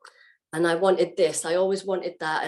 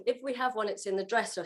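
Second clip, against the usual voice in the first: ordinary talk, nothing marked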